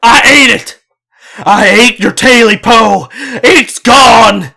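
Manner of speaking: high, loud panicked yell; a terrified old man confessing at a shout; frantic yell. Acted. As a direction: cry out in terror. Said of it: "Jake yelled".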